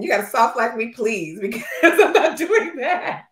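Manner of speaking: laughing through a playful refusal; laughing mock plea. Teasing her friend about the airport, laughing pleading. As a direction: plead jokingly while giggling.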